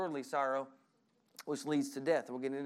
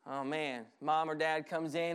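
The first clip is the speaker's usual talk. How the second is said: ordinary talk, nothing marked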